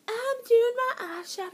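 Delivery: high put-on voice for Jody; chirpy mimicry of a friend; imitating Jody announcing she is doing her eyeshadow. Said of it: "Jody goes off into the bathroom".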